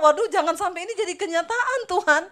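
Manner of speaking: loud, high comic dismay; mock-alarmed plea. Wife hoping the sign won't come true, mock-alarmed plea. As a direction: imitate begging God in exaggerated alarm.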